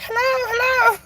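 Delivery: high-pitched mock greeting; girls saying hello. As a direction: put on a high voice for a playful hello. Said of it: "The girls are like".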